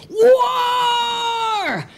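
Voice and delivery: long, high, drawn-out war cry; high stretched-out yell; boys' battle cry. Acted. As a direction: holler it like a boy charging into play.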